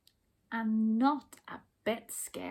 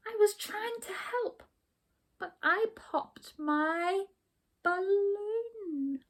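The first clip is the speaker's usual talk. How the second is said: high, timid little hare voice; nervous little bunny; high timid small voice; small shaky voice; 11 semitones higher than usual. Acted. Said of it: "in a small, shaky voice".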